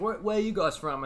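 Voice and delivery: lightly faked British tour-guide question; British accent. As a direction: ask it in amateur British. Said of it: "One time I did a British one".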